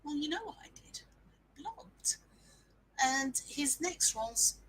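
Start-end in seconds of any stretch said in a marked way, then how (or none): 0.0-2.2 whisper. Retold or imitated. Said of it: "And I'm like".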